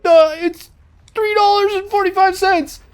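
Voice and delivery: high, loud squeaky stammer; mock nervous clerk. Clerk telling the robber the price, squeaky mock fear.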